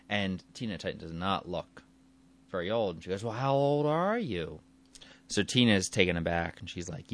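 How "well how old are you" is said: mock-pointed question, pitch climbing. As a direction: lift it high like a nosy challenge. Reporter challenges producer in retold story.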